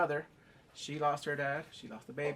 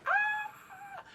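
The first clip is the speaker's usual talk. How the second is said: very high-pitched wailing shriek; mock childbirth scream. Queen having the baby, high mock scream. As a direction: let out a high put-on cry.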